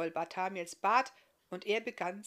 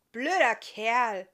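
slow, louder scornful muttered insult; sulky grumble; 4 semitones higher, 12 dB louder, much slower than usual. Acted. Iska grumbling about the baker's son, slow and scornful. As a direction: spit it out from a sulky corner. Sister sulks from the corner.

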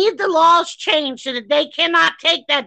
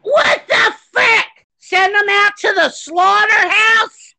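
outraged shout; 6 semitones higher than usual. Real, not acted. Host shouting in disbelief at the hospital's treatment, furious. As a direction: yell it in disbelief at the cruelty.

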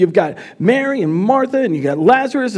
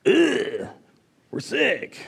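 mock misery; 6 semitones higher, 6 dB quieter, much slower than usual. Acts out real reaction to sickness.

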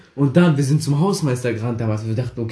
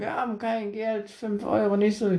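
high, much softer, meek plea; small and scared, pitched up and quiet; timid kids pleading; 8 semitones higher, 8 dB quieter than usual. Retold or imitated. Boys plead with machete bully, high and meek.

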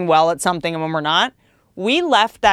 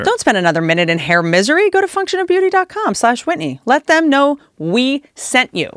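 higher, emphatic sponsor pitch, slowing at the end; ad read; 3 semitones higher than usual. Acted. Host pitching the sponsor to listeners, upbeat ad read. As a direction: sell it like a host ad spot. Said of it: "sponsor ad read".

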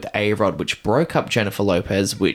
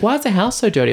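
higher, louder whine, mocking the housemates; mock whiny complaint; 12 semitones higher, 5 dB louder, faster than usual. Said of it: "everyone's like".

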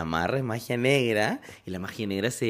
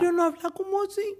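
much higher, squeaky imitation of a childish voice; mocking high voice. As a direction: mimic a little girl's put-on voice. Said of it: "una voz cuando uno tiene ira". (imitación aguda y burlona de voz infantil)

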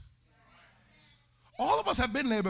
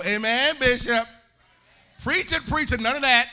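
mock congregation call-out; 3 semitones higher, 9 dB louder than usual. Mimicking congregation responses.

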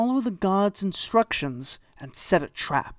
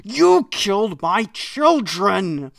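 slow, louder anguished scream at the eagle; 2 semitones higher, 5 dB louder, slower than usual. A narrator's reading. Snake screams at the eagle who killed his children, loud and slow. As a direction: hurl each word like an accusation. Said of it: "and he screamed".

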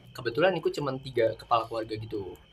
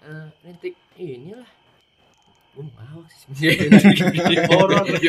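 laughing; 5 semitones higher, 16 dB louder than usual. Real, not acted. Laughs at podcast joke.

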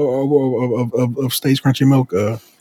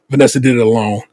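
normal talking voice

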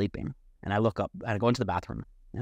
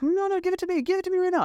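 high-pitched imitated screeching; re-enacted frantic shrieks; frantic angry demand; 3 dB louder, faster than usual. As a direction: shriek it while fighting for your phone. Acted struggle over the phone.

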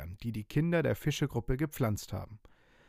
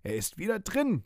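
higher, joyful cheer; 8 semitones higher than usual. Acted. Girl cheers as pirate vanishes into book. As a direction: cheer it like a happy child. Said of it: "jubelt Fienchen".

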